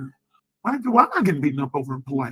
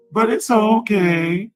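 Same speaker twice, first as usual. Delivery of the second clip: sing-song taunt; 5 semitones higher, 5 dB louder, much slower than usual. Real, not acted. Sing-song taunt after complaining.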